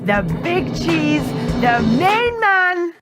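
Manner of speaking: slow, high grand title; grandiose. Acted. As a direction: ham up the big boss.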